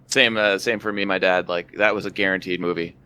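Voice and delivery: normal talking voice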